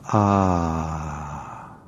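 long, contented "ah" on the out-breath; enjoyed exhale sigh. Shown as a demonstration. Teacher showing meditators an enjoyable exhale, long sighed "ah". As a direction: let out a drawn-out, relaxed sigh. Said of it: "as you exhale".

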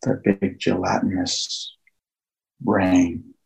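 slow, hushed meditation guide with long pauses; guided meditation. Acted. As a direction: ease listeners into calm, phrase by phrase, leaving long silences.

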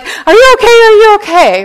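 high, loud frantic asking; panicked mom. Retold or imitated. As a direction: fuss over the child in a panic. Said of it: "panicking and rushing over and being like".

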